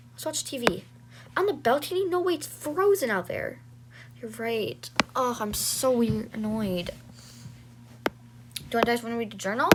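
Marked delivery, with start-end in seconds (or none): none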